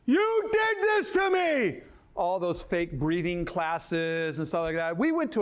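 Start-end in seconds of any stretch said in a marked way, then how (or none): 0.1-1.8 hammed-up wail of a mother in labor; wife in labor. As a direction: wail it like the birth is killing her. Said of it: "Kimberly was like".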